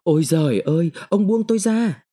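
high-pitched desperate cry, little added volume; narrated roar. A narrator's reading. Sáng fighting off the boss, crying out desperately. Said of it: "gầm lên".

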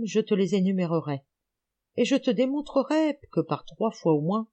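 plain reading voice

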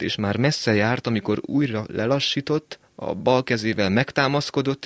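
usual speaking voice

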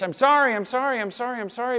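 high-pitched, rattled-off mock sorry; hasty insincere apology. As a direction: blurt sorry over and over just to end it. Acts insincere apology to end argument.

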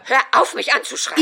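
mother yells at her daughter to stop; angry shout. Acted. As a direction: snap back at her. Mother accuses daughter of yelling.

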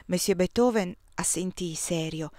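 ordinary delivery, nothing marked

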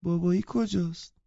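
put-on high-pitched child's question; little child. A narrator's reading. Child asking after her father, in a little-girl voice. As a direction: ask like a small girl.

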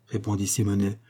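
usual speaking voice

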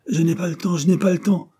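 high, emphatic brush-off; agitated refusal. A narrator's reading. Dare warding off Jane at the door, agitated refusal.

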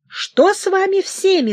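sharp high furious shout. A narrator's reading. King yells at his hunting party, furious shout. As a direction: yell at the whole hunting party. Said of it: "— закричал он".

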